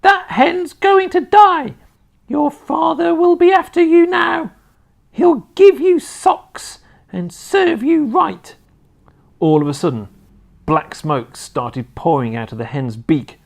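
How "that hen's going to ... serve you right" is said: put-on very high, loud old-woman screech; shrieking, gloating telling-off; scolding Grandma shout. A narrator's reading. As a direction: shout dire warnings at the boy, slow and piercing. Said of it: "Grandma shouted".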